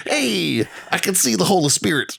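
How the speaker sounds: high-pitched mock televangelist; hammed-up money-minded preacher, pitched well up; Joel Osteen. Acting Joel Osteen as greedy preacher.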